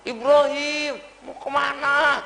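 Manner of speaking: high, drawn-out mock holler; seruan memanggil. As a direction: imitate a wife calling after her husband. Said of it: "Digeroin sama istrinya". (panggilan tinggi, ujungnya dipanjangkan)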